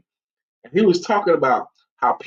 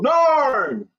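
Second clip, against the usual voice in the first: shouted much higher, cheerful bar greeting; bar crowd greeting; 9 semitones higher than usual. Retold or imitated. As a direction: call his name like the whole bar welcoming him. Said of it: "everyone goes".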